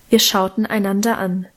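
plain reading voice

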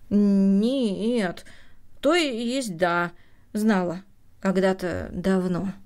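slow, faltering self-correction; halting, drawn-out reply, sinking at the end; hesitant admission. A narrator's reading. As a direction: stumble from denial into reluctant admission. Grandmother falters, correcting herself.

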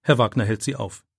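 ordinary delivery, nothing marked

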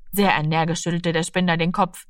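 usual speaking voice

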